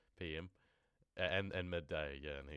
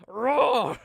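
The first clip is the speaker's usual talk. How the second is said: huge high loud roar; playful roar; 23 dB louder than usual. Acted. Hillbilly roaring at the traveller, loud playful roar. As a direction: roar like a beast.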